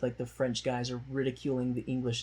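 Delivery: normal talking voice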